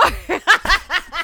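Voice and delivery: high burst of laughter; real laugh. Real, not acted. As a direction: crack up at the memory. Mom laughing retelling spill.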